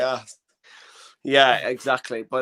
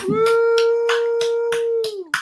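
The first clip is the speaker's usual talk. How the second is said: cheering. Real, not acted. Host cheering intro.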